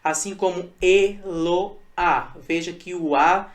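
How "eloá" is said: "eloá" drawn out slowly as e, lo, á; syllable by syllable. Shown as a demonstration. Teacher showing a lone final vowel, "eloá" split slowly into syllables. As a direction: spell out "eloá" in three slow, separate syllables. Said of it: "a sílaba tônica foi a última sílaba".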